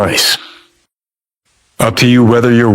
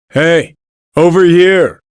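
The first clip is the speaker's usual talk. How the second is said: higher-pitched call to get attention; 7 semitones higher than usual. Acted. Game character beckoning a companion over, calling out.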